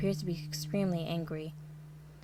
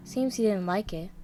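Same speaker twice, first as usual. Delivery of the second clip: ordinary delivery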